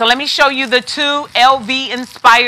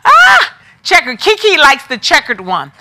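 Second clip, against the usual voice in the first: excited call-out; 4 semitones higher than usual. Real, not acted. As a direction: call it out with delight. Spots viewer Kiki's choice.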